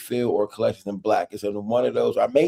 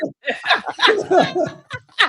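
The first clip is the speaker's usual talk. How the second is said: very high crying laugh; high wailing laugh. Pretend. Thanking his defender, high wailing laugh. Mock wail after being defended.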